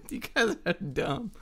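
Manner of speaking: high-pitched, slow laughing words. Real, not acted. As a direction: talk through real laughter. Laughing after his own joke.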